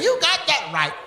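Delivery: high, pointed agreement; mock angry woman. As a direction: imitate a fed-up woman. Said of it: "But she said".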